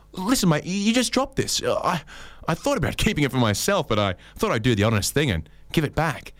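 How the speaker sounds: high, earnest honest-bloke voice; mock earnest stranger. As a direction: play the overly honest passerby. Acted honest stranger quote.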